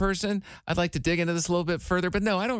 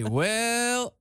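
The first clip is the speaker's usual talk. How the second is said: stretched "Well", pitched up; drawn-out "well"; 5 semitones higher than usual. Shown as a demonstration. Showing his crutch word, a long high "Well". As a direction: draw out "Well" as a filler. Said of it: "using the word, um, as a crutch phrase".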